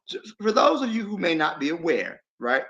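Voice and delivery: normal talking voice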